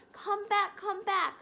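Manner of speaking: urgent call that eases at the end. A narrator's reading. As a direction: call after the fleeing mice. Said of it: "he called to the mice".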